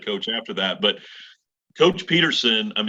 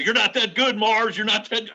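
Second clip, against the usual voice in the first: retold taunt, pitched way up; mock heckling; 10 semitones higher than usual. Fans taunting the opposing pitcher, high mock jeer. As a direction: imitate the dugout taunting the pitcher. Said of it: "They're screaming at it".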